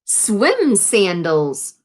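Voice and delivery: ordinary delivery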